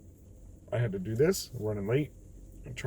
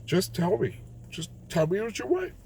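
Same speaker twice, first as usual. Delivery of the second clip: begging, pitch climbing higher each phrase; exasperated pleading; 7 semitones higher than usual. Retold or imitated. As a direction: plead with a whining kid, fed up. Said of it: "It's like".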